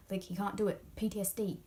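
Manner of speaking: ordinary talk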